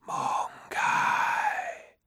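breathy wind-borne whisper; gusting windy whisper. Acted. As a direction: let the wind carry the word. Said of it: "whispered the wind".

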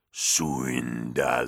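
low, slow, growled "Suindala"; withered god growl. Acted. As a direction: drag "Suindala" out as a dying god's growl. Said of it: "growled the withered god".

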